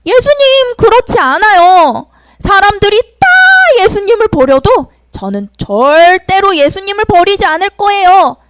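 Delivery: high, loud confident pledge; eager vow. Acted. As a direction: boast it to Jesus with conviction. Peter boasting to Jesus.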